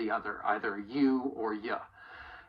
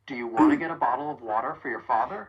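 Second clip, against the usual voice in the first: rapid and run together; quick American reductions; fast connected speech; 5 dB louder, faster than usual. Shown as a demonstration. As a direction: rattle it off smoothly. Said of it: "I want you to say it with".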